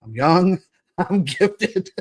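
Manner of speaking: a mirror pep talk that cracks you up; laughing self-affirmation. Pretend. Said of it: "That's what I tell myself every time".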